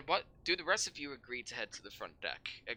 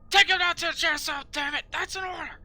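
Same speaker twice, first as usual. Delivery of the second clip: high, loud furious command; screamed order; 12 dB louder than usual. Acted. Sergeant screaming at the guards, high and loud.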